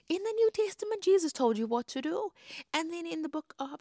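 high, loud preaching retort; forceful rebuttal. Real, not acted. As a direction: hit back at the objection. Rebuts an expected objection.

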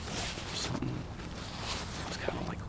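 soft unvoiced whisper. Real, not acted. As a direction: keep it hushed and low. Quiet at night near wildlife.

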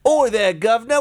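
high, hammed-up Cockney call; bad Cockney accent. Co-host mimicking Mary Poppins film, bad Cockney accent. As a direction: do a terrible Cockney chimney sweep. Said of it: "with a bad Cockney accent".

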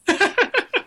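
loud high laugh bursting out; high burst of laughter. Real, not acted. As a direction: laugh hard at the punchline. Laughing at own story.

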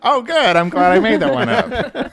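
high, laughing delight; real giggly relief, high-pitched. Real, not acted. Relieved laughter over made-up rule.

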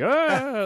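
high, loud drawn-out "ah"; playful alarmed whoop; mock yell. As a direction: imitate a dad's fake-drop holler. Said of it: "go like".

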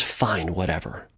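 soft, low, mildly dismissive; bored dog. Acted. Bored dog giving up on the humans, muttered low. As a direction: give up like a bored dog, dropping low and quiet. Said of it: "the dog gets a little bored".

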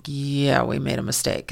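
low, subdued admission with no emotion; Bill Belichick. Retold or imitated. Coach stoically owning up to a mistake, low and deadpan. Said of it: "I'm saying it in his, his tone".

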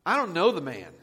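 loud, forceful denial; emphatic oath. Acted. Peter denies Jesus to a girl's accusation, emphatic and loud. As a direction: swear it out loudly, as if under oath. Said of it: "with an oath".

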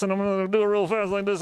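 normal talking voice

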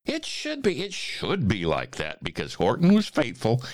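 triumphant storybook ending, high then settling; story narration. A narrator's reading. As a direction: start high and jubilant, ease into the close. Reads a rhyming story aloud.

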